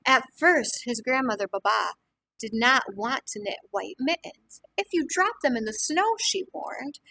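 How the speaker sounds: lively storybook reading to a class; reading to children. A narrator's reading. Teacher reading a picture book to the class, lively. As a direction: read it warmly to young listeners.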